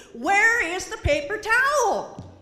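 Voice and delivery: high, loud, slow frustrated cry; dripping hands, loud exasperated question; exasperated plea. Retold or imitated. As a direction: demand the towel, fed up.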